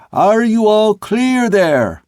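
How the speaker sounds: high, slow, stretched-out holler; sustained high call through cupped hands; long-drawn shout. A narrator's reading. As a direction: hail aloft with every word drawn out. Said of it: "he shouted again".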